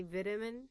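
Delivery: vitamin with a short first i; vitamin starting like "vit" in "vitality"; British pronunciation. Shown as a demonstration. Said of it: "the tone and the English accent".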